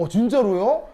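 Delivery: loud, high astonished question. Real, not acted. Teacher startled by student's claim of seeing classmate angry, astonished. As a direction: blurt it out in disbelief.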